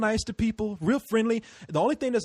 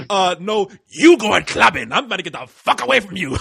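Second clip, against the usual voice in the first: mock refusal; 2 semitones higher, 8 dB louder than usual. Refusing the crazy friend's clubbing invite, loud mock protest. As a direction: turn down the crazy friend loudly, half laughing. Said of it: "I like".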